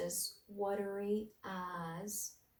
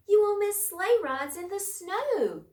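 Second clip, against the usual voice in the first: much higher, louder mouse voice; pleading mouse; 12 semitones higher, 8 dB louder than usual. A narrator's reading. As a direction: plead brightly like a tiny mouse.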